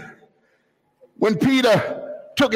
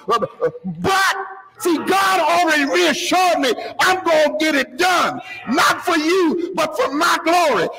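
high-pitched, sustained pulpit cry; fervent preaching; 8 semitones higher than usual. Real, not acted. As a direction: preach it hard, voice up high throughout. Preacher at full force.

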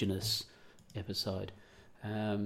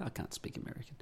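softer whispered self-comment; whispered aside; 4 semitones higher, 8 dB quieter, faster than usual. Real, not acted. Host whispers an aside after his imitation. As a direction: drop to a whisper, talking to yourself.

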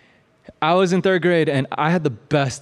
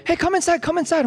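teacher calling out. Retold or imitated. Teacher voiced in story.

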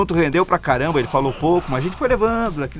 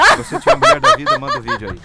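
squealing with laughter; giggling high over the words; laughing; 6 dB louder than usual. Real, not acted. Joking invitation, laughing high.

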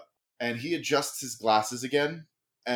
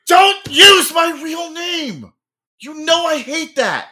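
much higher and louder, slow whiny protest; indignant shout; 14 semitones higher, 13 dB louder, slower than usual. Acted. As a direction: yell at her to stop. Brother objecting to real name.

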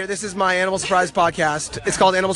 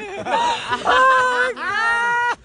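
real laugh. Real, not acted. Friend cracking up at an omelet joke, real laugh. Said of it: "It's actually a real laugh".

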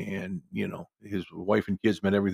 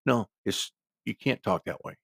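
ordinary delivery

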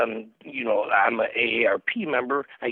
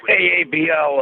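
gruff voice; 2 semitones higher, 7 dB louder than usual. Real, not acted. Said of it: "if you can't tell by my gruff voice".

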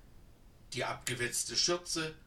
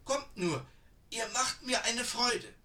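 put-on eager old woman; 9 semitones higher than usual. A narrator's reading. Old woman offers tea to the tired sisters, high and eager. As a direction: coax them in like a keen old granny.

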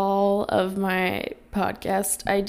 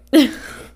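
short loud laugh; burst of laughter; 6 semitones higher, 10 dB louder than usual. Real, not acted. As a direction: laugh it off loudly. Laughing at own sass.

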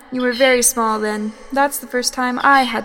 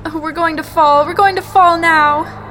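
terrified cry that we will fall; rising panic; 3 semitones higher, 6 dB louder than usual. Acted. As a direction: build from fear into a cry. Plane breaking apart.

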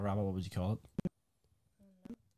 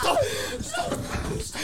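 laughing stop; slower than usual. Real, not acted. Friend spraying, laughing.

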